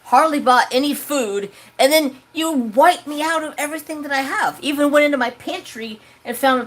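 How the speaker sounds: high, rising, heated tirade; berating relatives who emptied the pantry; angry scolding. Retold or imitated. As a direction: lay into them. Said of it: "I was like".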